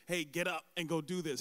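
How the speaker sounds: a mock bossy order the Lord would never give; mock bossy command. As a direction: bark it like a pushy boss, half in jest. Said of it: "So he doesn't say".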